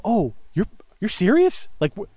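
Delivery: high-pitched, disbelieving questions; incredulous. Retold or imitated. Customer questions the worker's Walmart suggestion, incredulous.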